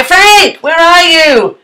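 high, loud call into the next room; calling for Frank to appear. Real, not acted. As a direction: shout for her from afar.

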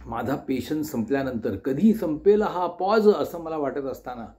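usual speaking voice

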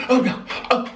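ordinary talk

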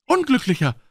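high, loud cry of dismay; called out. Acted. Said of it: "rief er". (hoher, lauter Ausruf)

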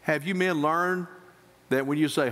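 ordinary talk, nothing marked

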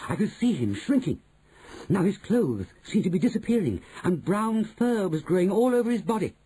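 high-pitched, urgent storytelling; high, quickening, wonder-struck narrator; excited story narration. A narrator's reading. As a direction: build amazement as it all happens.

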